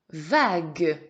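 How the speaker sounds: Vague loud and drawn out; emphatic dictation. Shown as a demonstration. Teacher has pupil listen for syllable, Vague loud and stretched. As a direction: stretch Vague, sounding the final "gue".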